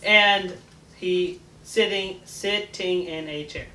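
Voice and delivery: slow, syllable by syllable; counting syllables. Shown as a demonstration. Girl recounting the haiku syllables, slow and deliberate. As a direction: spell out each beat slowly. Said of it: "Sorry, miscounted".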